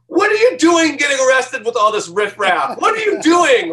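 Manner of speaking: high, loud shouted mom scolding; scolding mother. Retold or imitated. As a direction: shriek it like an outraged mother. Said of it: "my mother calling me going".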